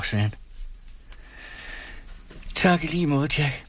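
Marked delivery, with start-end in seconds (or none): 2.5-3.6 tired. A narrator's reading. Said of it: "sagde hun træt".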